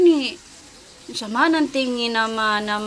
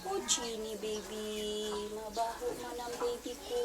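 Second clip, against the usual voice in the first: tender murmur; 3 semitones lower, 16 dB quieter than usual. Real, not acted. Tender talk to clean dog.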